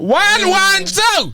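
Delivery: high slow drawl. Pretend. Drawn-out sung repeat of number.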